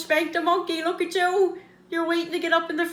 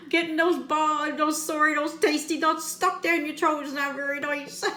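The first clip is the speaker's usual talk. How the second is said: usual speaking voice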